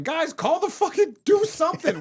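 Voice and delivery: high-pitched, exasperated call for action; retold urgent appeal. Man holding attacker appeals to bystanders, high frantic call. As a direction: call out to useless onlookers.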